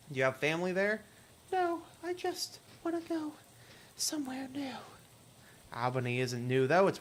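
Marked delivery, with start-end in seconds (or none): 1.5-4.7 put-on much higher, thin ghostly girl voice; hitchhiker Lydia. Hitchhiker asking the player for a ride, high ghostly voice. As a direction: play the strange girl in the rain begging a lift.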